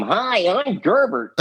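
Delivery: high, slow puppet greeting; Gerbert. Pretend. Friends at a diner ask him for the puppet voice, high slow puppet greeting. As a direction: say hi as a sweet high puppet, slowly. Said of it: "do, do Gerbert's voice".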